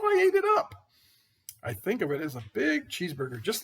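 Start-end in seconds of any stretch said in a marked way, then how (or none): 0.0-0.7 gleeful. Acted. Wolf's gleeful confession.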